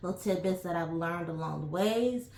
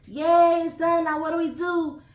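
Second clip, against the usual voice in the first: much higher and louder, gushing like a clueless tourist; mock giddy traveler; 11 semitones higher, 9 dB louder than usual. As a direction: play the carefree traveler who planned nothing, bubbly and loud. Mocking travelers once trip is booked.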